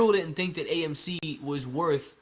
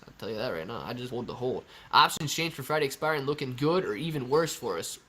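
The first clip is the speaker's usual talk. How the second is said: ordinary talk, nothing marked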